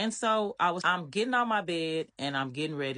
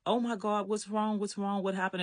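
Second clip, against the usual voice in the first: higher-pitched, mildly alarmed questioning; alarmed concern; 6 semitones higher than usual. Retold or imitated. Woman trying to console her screaming friend, mildly alarmed. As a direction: retell worried questions in a raised pitch.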